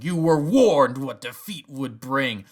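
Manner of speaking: loud, slow menacing threat. Retold or imitated. Fan imitating Vader storming into the castle, loud and slow. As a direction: lean in and threaten slowly. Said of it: "really raise his voice".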